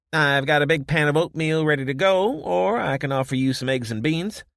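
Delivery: high, loud, bright offer of food; cheerful barkeep. Acted. Josie offering breakfast to her guests, high and cheerful. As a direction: sell the breakfast with a smile.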